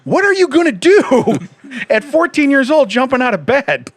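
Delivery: high, loud disbelieving question; retold incredulous dad; amused incredulity. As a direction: challenge it loudly through laughter. Incredulous retort to his son.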